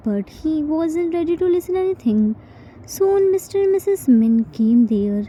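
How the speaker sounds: ordinary delivery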